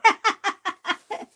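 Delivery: a sudden high, loud laugh; high burst of laughter. Real, not acted. Crafter laughing at her own name mix-up, loud high laugh.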